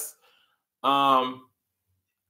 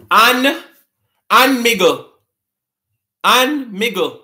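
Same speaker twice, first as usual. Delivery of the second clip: patois "Anne Miggle", higher and louder; Jamaican patois riddle; 4 semitones higher, 4 dB louder, slower than usual. Shown as a demonstration. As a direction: pose the patois riddle with a lift. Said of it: "We don't pronounce a lot of our letters in patois".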